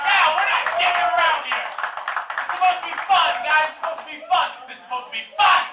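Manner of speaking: loud, insistent barking at the crowd; comic berating the crowd, loud and aggressive. Real, not acted. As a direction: demand they have fun. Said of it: "That seemed a little aggressive".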